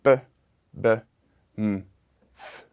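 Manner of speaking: "b, b, m, f" as bare sounds, one by one; isolated labial consonants. Shown as a demonstration. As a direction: sound out "b, b, m, f" with a pause after each. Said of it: "the labial consonants in that language".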